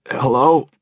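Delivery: a hoarse, groggy croak. Acted. Woken by the phone, answers in a croak. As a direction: croak it out half awake. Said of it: "I croaked".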